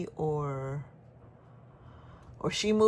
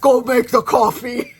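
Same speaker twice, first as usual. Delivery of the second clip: loud, higher-pitched shouted command; aggressive order; 6 semitones higher, 16 dB louder than usual. Retold or imitated. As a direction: bellow it as a bossy order, half laughing. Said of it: "maybe it was a little too aggressive".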